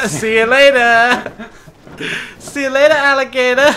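laughing taunt. Real, not acted. Taunting while flying away.

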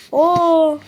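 real amazement at the discovery; amazed "Oo!". Real, not acted. Finds a secret room.

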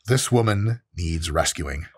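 ordinary talk

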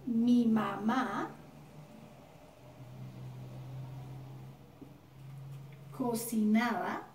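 very slow, word by word; slow dictation. Shown as a demonstration. Teacher dictating an example sentence to students, slowly. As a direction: dictate it so they can write. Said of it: "aquí creamos una oración".

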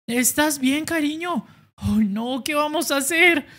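high, anxious fussing; high voice full of worry; worried high voice. Acted. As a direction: fret over the hurt boxer. Worried character voice.

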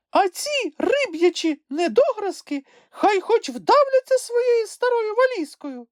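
very high squeaky indignation; high-pitched, huffy creature voice; scornful little creature. A narrator's reading. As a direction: dismiss them in a tiny high voice. Sniff scornfully gives his verdict.

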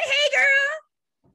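high, loud, drawn-out cheer; excited call-out. Real, not acted. Host cues her best friend to greet, excited call-out.

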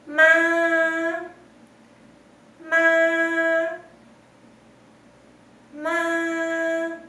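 "ma" held long on one high note; teacher models "ma" high and level. Shown as a demonstration. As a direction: sustain each "ma" high without bending it. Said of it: "Es alto, sostenido".

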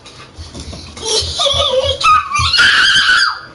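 high shrieking burst of laughter; screaming laugh. Real, not acted. Huge laugh at game.

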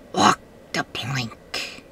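low, slow pirate threat; slow low pirate menace. Pretend. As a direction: drag it out like a pirate captain. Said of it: "I'm a pirate".

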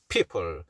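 "people" low, with both Ps made by closed lips. Shown as a demonstration. Teacher showing the P in "people", lips meeting. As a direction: press the lips together for each P in "people". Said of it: "입술이 붙는 피읍이죠".

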